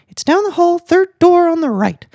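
high falsetto waitress voice; squeaky-high joke character helping a customer; Texan waitress. Acted. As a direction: give directions in a put-on, very high voice. Waitress gives directions.

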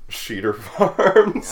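high, slow, disbelieving laugh; incredulous laughing. Real, not acted. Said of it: "no way that's a real name".

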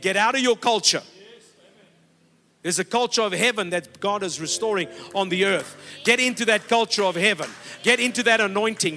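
loud, driving preacher's exhortation; preaching at full force. Acted. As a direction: hammer each call to the congregation.

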